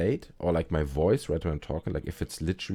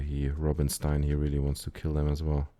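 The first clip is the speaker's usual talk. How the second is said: ordinary delivery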